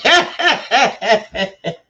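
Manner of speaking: high, loud cackling laugh; high burst of laughter. Real, not acted. Laughing at his own joke about his grandson. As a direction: crack up at your own joke.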